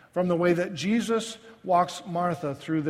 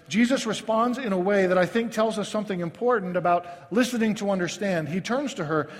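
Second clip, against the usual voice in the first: ordinary delivery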